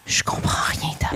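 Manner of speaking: breathy whisper of confusion. Retold or imitated. Friend mimics being lost in an English film, whispered.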